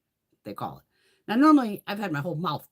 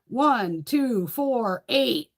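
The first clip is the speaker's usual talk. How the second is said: louder, higher mock read-off of measurements; calling out numbers; 8 semitones higher, 6 dB louder, much slower than usual. Dental hygienist reading gum numbers to a colleague, loud mock call-out. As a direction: imitate a hygienist calling out gum depths, rising as it goes. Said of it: "the chick will, like, read it off to somebody else".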